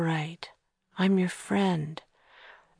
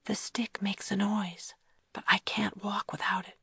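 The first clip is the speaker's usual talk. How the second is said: whispering; 4 semitones higher, 8 dB quieter than usual. Acted. Sneaking past sleeping rooms.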